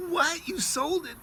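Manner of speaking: very high, shocked question; upset disbelief. Retold or imitated. Buyer learning the deal is gone, shocked. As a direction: re-enact shock, voice shooting way up. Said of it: "very upset with him".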